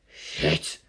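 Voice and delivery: low unvoiced curse; a hushed shocked swear; whispered curse. Delta One sees the angels and swears under breath.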